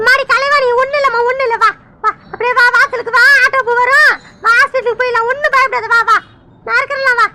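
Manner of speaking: a fussing grandmother rushing to help; worried grandmother. Acted. Grandmother voice again.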